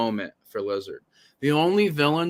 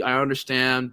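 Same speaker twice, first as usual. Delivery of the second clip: ordinary talk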